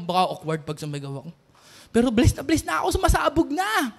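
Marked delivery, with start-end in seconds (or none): 0.0-1.3 low, soft inner voice; thinking to oneself. Retold or imitated. Worshipper in a silent crowd wanting to shout, hushed thought. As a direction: voice a hesitant private thought, much softer and lower. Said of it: "Nag-iisip ka lang".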